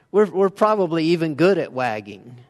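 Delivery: ordinary talk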